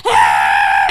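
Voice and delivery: loud low mock shout imitating him; mock yell. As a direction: bellow it like a driver in a panic. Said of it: "You was like".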